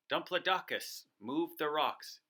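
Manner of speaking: usual speaking voice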